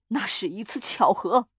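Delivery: ordinary delivery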